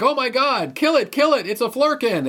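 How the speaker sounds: high, frantic squeal of alarm; frantic high Rocket voice; panicked Rocket. Retold or imitated. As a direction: shriek it like a panicked raccoon. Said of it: "is like".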